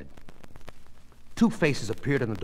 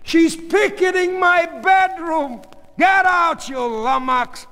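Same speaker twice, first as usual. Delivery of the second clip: high, loud ranting; outraged shouting; 8 dB louder, slower than usual. Acted. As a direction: shout in outrage to the heavens, then at her. Father rants at ceiling, daughter.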